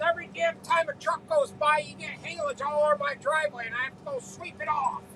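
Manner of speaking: a retold gripe, only lightly voiced; irate neighbor. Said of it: "still irate".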